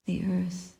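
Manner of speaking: ordinary talk, nothing marked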